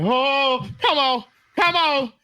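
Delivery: high, pleading call to hurry; retold urgent calling. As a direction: urge the ambulance on, acted out. Retelling calls to approaching ambulance.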